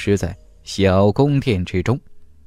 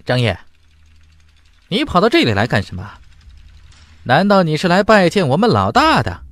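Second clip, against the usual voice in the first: cold sneer; 7 semitones higher than usual. Acted. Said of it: "冷冷地说道".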